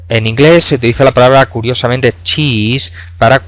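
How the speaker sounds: ordinary delivery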